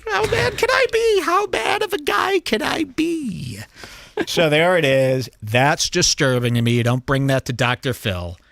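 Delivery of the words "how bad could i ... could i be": very high, held-note mock wail; Trump. Host quotes the president reacting, absurdly high sustained voice. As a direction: pitch it way up and sustain every word. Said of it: "Trump says".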